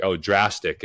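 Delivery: ordinary talk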